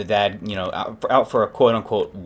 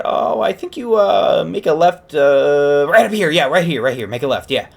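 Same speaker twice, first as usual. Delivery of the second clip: higher, louder grandma giving directions; 7 semitones higher, 5 dB louder than usual. Acted. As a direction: play a hesitant grandma navigating, pitched up. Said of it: "And the grandma said".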